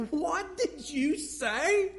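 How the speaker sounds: high, slow, half-laughing what did you say; shocked, disbelieving question. Retold or imitated. Father stunned by his son's words, slow shocked question. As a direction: let the disbelief stretch it out. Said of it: "I said".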